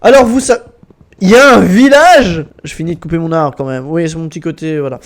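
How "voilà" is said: usual speaking voice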